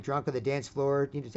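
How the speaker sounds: ordinary talk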